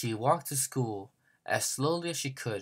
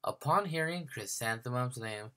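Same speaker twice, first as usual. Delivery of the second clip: plain reading voice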